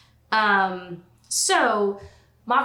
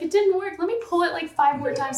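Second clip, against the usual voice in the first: a light, raised-pitch take on a flustered brother; imitating Bolin; 8 semitones higher than usual. Bolin yanking the lever, voiced higher.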